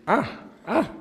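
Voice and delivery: high, loud gloating taunt; mocking gloat. [name] burlándose de Jairo con sarcasmo fuerte. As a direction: rub it in with a cackling sneer.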